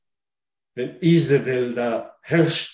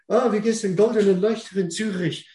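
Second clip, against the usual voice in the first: higher, fast, chirpy mock hello; mock greeting; 10 semitones higher, faster than usual. Preacher mimicking a church greeting, higher and quick. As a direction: play a cheery churchgoer greeting a friend.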